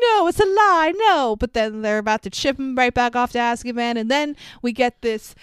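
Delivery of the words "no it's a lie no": high, loud desperate denial; desperate protest. Retold or imitated. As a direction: cry out that it's a lie. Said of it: "Karkaroff's like".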